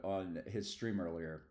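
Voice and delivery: ordinary delivery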